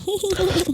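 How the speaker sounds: high-pitched "hee-hee" giggle; mock child giggle; imitated children's laughing. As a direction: mimic eerie little children giggling, very high. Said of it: "You hear the children laughing".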